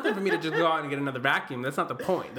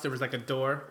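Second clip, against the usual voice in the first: usual speaking voice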